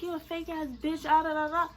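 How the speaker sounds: ordinary talk, nothing marked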